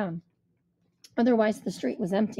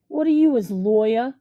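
tough guy sneer; 8 dB louder than usual. A narrator's reading. Thug in ugly shirt mocks.

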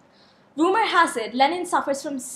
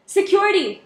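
calling out; 6 semitones higher, 4 dB louder than usual. Acted. Host calls for guards.

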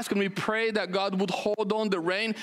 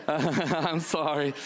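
slow apology broken by laughter; laughing apology; 5 semitones lower, much slower than usual. Real, not acted. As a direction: laugh through the apology to newcomers. Apologizing again while laughing.

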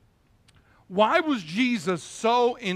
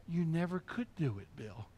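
much softer, almost hushed; the Lord's quiet voice; 3 semitones lower, 13 dB quieter than usual. Retold or imitated. The Lord speaking quietly to Bill, hushed. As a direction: drop right down, gentle inner voice. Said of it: "you hear his voice saying".

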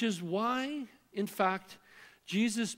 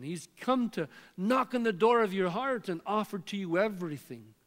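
ordinary talk, nothing marked

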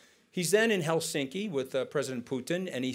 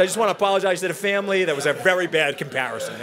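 loud, fast, higher imitation of a grudging apology; mock presidential apology; 4 semitones higher, 8 dB louder, faster than usual. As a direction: rattle off a hammed-up apology, quick and loud. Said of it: "He said".

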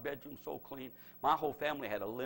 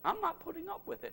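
much higher, defiant declaration; rising in defiance. Shown as a demonstration. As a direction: proclaim "not putting up with it" as faith. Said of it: "Faith says".

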